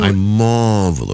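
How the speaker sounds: slow, drawn-out Locust Valley lockjaw. Acted. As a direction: drawl it like Long Island old money. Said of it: "my Locust Valley lockjaw".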